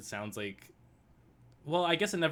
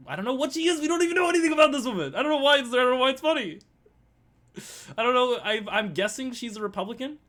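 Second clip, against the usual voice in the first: loud, high-pitched exasperated outburst; comic meltdown, loud and high; frustrated rant; 11 semitones higher, 7 dB louder, faster than usual. Real, not acted. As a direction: rattle it off in a frantic high yell. Frustrated rant about unknown woman.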